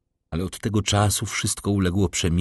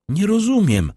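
high, loud, nervous outcry; trembling cry; 12 semitones higher, 5 dB louder than usual. A narrator's reading. The baroness crying out to Villefort in panic. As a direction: cry it out, shaking with nerves. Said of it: "zawołała pani Danglars, dygocąc nerwowo".

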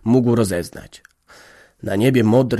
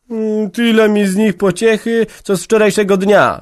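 put-on high, louder young woman's outburst; exclaiming bitterly; 11 semitones higher, 6 dB louder than usual. A narrator's reading. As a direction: cry it out on the edge of tears. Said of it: "wykrzyknęła i rozpłakała się". (Jagna wybucha z żalem)

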